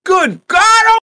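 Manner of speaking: high, loud hammy shout; farmer shout. Retold or imitated. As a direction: yell it like a frantic old farmer. Acting the farmer's outburst.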